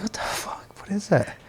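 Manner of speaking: whispered shock in the dark; frightened whisper. Retold or imitated. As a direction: hiss it out, startled and hushed. Seeing a shadow in the dark hallway.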